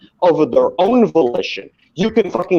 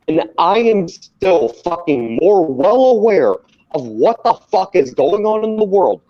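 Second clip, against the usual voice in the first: ordinary talk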